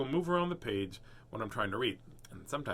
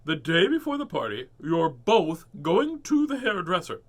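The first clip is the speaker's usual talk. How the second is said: firm mother, lightly voiced; 3 semitones higher, 9 dB louder than usual. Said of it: "Cranny's mother".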